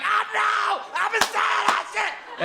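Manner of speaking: hammed-up, very high frantic shout; over-the-top excited yell, pitch through the roof; losing his mind. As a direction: shriek it in mock hysteria. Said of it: "And he'll lose his mind".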